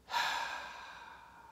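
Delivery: long, unvoiced sigh; exasperated exhale; weary sigh. Real, not acted. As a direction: let out a long, weary breath. Despairs at the movie reviewed.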